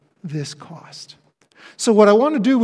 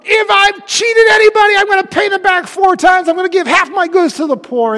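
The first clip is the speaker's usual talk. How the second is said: much higher and louder, eager tax collector; voicing Zacchaeus; 10 semitones higher, 9 dB louder than usual. Preacher voicing Zacchaeus to the church, high and eager. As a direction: blurt out the promise in a rush of repentance. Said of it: "hearing Zacchaeus go".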